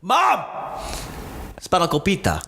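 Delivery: put-on calling mum in a light Greek accent; sudden Greek. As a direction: switch into Greek to call mum. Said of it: "then it goes back into".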